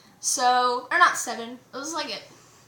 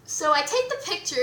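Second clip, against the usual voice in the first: ordinary talk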